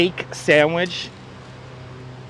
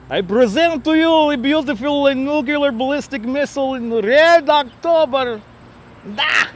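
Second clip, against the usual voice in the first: higher, slow hammy Russian announcer; mock Russian accent; 8 semitones higher than usual. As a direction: present it like a proud Soviet host. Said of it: "mock Russian presentation".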